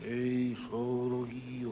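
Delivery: low, slow, hushed spooky voice; ghost voice. Pretend. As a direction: speak like a ghost from beyond. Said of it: "Faccio la voce da fantasma".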